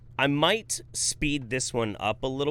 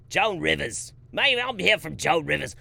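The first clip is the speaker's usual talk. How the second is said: higher-pitched New Jersey accent attempt; badly faked New Jersey accent, pitched up; Joan Rivers; 6 semitones higher, 4 dB louder than usual. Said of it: "How do you do a New Jersey accent?".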